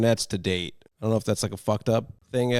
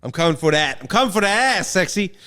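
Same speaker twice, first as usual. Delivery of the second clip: higher, louder mock challenge; playful taunt; 8 semitones higher, 4 dB louder than usual. Real, not acted. Podcast co-host trash-talks a rival over the tie break, playful loud taunt. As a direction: call out your rival, building higher.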